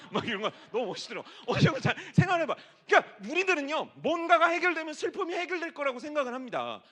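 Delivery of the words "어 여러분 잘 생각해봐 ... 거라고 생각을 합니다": loud, high, fast pulpit delivery; preaching. Acted. Pastor preaching to the congregation, loud and high. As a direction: drive the point home to the congregation.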